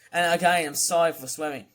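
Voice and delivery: ordinary delivery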